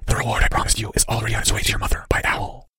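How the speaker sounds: soft, voiceless whisper; unidentifiable whisper. A narrator's reading. Cloaked figure promises the boy payment, secretive whisper. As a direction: whisper as a hidden conspirator. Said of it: "said the unidentifiable whisper".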